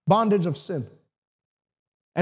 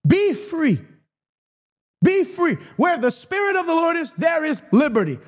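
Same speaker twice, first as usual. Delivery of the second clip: preaching at full force; 3 semitones higher than usual. Real, not acted. Preacher commanding listeners to be free, preaching at full force.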